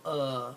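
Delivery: "uh" with the mouth barely moving; schwa demo. Shown as a demonstration. Teacher showing how little the mouth moves, relaxed "uh". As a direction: model the lazy "uh". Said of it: "I just go".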